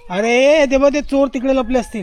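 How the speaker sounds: calling out. Real, not acted. Vlogger shouts to kids where the thieves hide, loud and high. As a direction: shout a hint across the yard.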